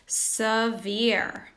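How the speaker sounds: "Severe" with the first E a weak "suh"; modelled word. Shown as a demonstration. Teacher modelling "Severe" for repetition. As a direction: lean on the second beat of "Severe". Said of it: "Do you hear ah in severe".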